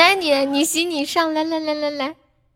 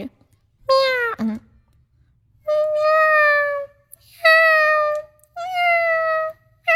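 cat meow; much faster than usual. Shown as a demonstration. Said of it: "我先找一下感觉".

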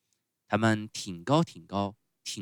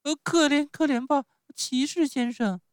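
put-on very high puppet-child voice; pleading Pinocchio; 8 dB louder than usual. Pinocchio begs the showman for pity, high pleading. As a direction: beg for mercy in a piping voice.

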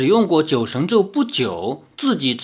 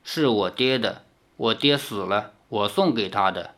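flat and blank; 5 semitones lower, 6 dB quieter than usual. Acted. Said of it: "依然木然而立".

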